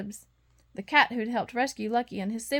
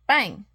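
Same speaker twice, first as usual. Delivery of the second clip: a sudden loud bang; 7 dB louder than usual. A narrator's reading. Narrator voicing a sudden loud bang in the night. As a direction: slam the word out like a door banging. Loud sound effect in story.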